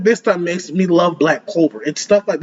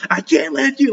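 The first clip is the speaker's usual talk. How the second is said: pitched way up, imitating a scream; half-yell it, high and strained; mock scream; 8 semitones higher than usual. Reacting to an anime hero screaming at the devil, mock yell. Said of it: "He's like, he's screaming".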